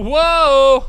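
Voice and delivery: normal talking voice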